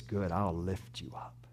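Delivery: soft, near-whispered comfort; barely voiced, gently urging them up; a hushed, loving invitation; tender whisper. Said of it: "He says".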